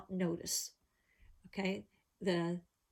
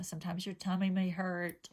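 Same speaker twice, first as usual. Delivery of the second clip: ordinary delivery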